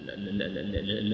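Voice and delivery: ordinary delivery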